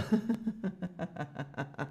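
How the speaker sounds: quiet amused giggle; laugh. Real, not acted. Teacher giggles after her cheer.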